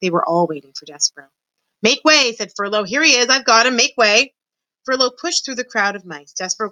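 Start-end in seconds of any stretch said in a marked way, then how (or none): 1.8-2.3 higher-pitched call through a crowd. A narrator's reading. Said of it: "shouted Furlow". 2.9-4.3 raised, higher-pitched announcement; calling over a crowd. A narrator's reading. Mouse pushing through a crowd.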